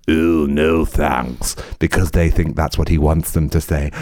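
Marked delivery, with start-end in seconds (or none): 0.1-1.5 drawn-out, slow mock crowd groan. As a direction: stretch it out like a reluctant crowd. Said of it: "the crowd will go".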